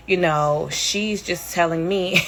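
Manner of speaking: usual speaking voice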